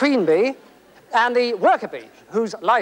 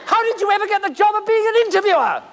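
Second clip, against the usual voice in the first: fast, higher and incredulous; exasperated; 9 semitones higher, faster than usual. Acted. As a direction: rattle off the insult. Exasperated guest.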